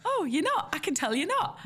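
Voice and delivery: high sing-song calling-out; exaggerated high-pitched disbelief; playfully singsong, as if seeing through them; mock knowing retort. Retort to insincere congratulations, mimicked.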